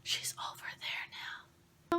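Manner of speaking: slow, hushed imitation of a child's whisper. Little boy secretly asking about the visitor, imitated whisper. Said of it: "then I hear him whisper".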